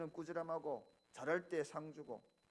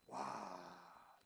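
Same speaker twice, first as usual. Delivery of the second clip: ordinary talk, nothing marked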